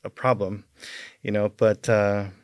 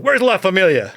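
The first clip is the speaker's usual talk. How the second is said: adrenaline-fuelled demand; 8 semitones higher, 7 dB louder than usual. Retold or imitated. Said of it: "I was like".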